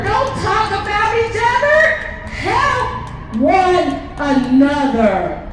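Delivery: fervent rally speech, slow and loud; preaching. Real, not acted. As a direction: preach it to the crowd.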